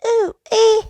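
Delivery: very high loud monkey squeals; monkey screech. A narrator's reading.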